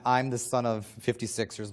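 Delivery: usual speaking voice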